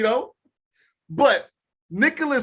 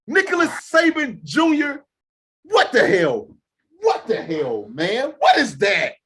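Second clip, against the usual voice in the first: exasperated; 5 semitones higher, slower than usual. Real, not acted. Exasperated outburst at Saban.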